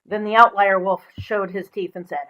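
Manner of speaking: ordinary delivery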